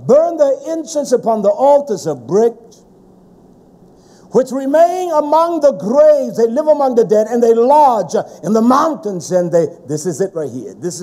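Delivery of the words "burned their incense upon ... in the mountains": loud scripture reading, pitched up; forceful preaching. Acted. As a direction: proclaim the verse to the congregation.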